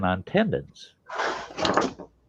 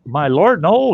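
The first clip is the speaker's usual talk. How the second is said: loud, rising-pitched dismay; shocked disbelief; 9 semitones higher, 7 dB louder, slower than usual. Retold or imitated. Surgeon hearing no anesthetic was used, shocked disbelief. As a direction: exclaim it high in disbelief.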